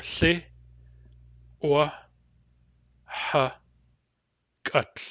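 Thli, Wa, Ha, Gut with long gaps; syllable by syllable. Shown as a demonstration. Teacher spelling out syllables one by one. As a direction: pause after each syllable. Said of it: "The syllables are".

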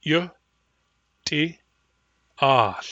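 each syllable said apart with pauses; syllable-by-syllable drill. Shown as a demonstration. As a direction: split it into separate syllables. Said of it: "By syllables".

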